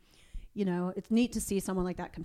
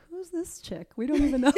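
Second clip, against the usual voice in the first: high, mock-bewildered aside, half laughing; a playful imitation of a confused mother; puzzled mom; 7 semitones higher, 6 dB louder than usual. Imagined mom's puzzled reaction.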